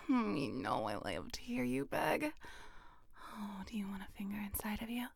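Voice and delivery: soft breathy unvoiced whisper close to the mic; intimate whisper. Partner being teased gently, in an intimate whisper.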